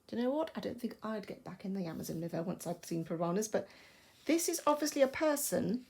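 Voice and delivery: normal talking voice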